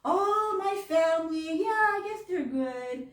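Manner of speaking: lilting mock reply; cashier, sing-song. Cashier answering about her family, in a sing-song mock voice. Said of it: "She said".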